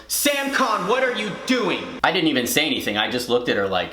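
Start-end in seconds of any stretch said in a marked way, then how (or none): 0.1-1.8 mock teacher yell. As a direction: imitate a teacher catching someone out. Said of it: "Miss Boffman suddenly yells".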